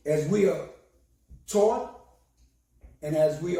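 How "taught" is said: usual speaking voice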